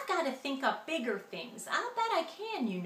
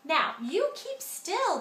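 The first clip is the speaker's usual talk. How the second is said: higher, slow sharp snap; snapping; 6 semitones higher, 3 dB louder than usual. A narrator's reading. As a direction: put her in her place. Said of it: "the glunk snapped back".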